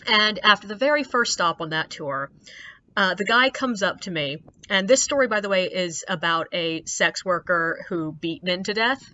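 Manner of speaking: ordinary delivery, nothing marked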